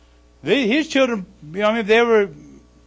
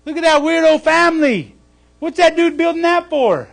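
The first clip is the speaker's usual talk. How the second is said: loud, higher jeering; mocking passersby; 8 semitones higher, 9 dB louder than usual. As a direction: shout it out to ridicule the weirdo. Passersby mock Noah for years.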